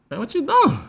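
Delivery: very high, pointed question; high-voiced demand to know; different tone. Shown as a demonstration. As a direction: shoot the pitch way up. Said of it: "a little bit of different tone".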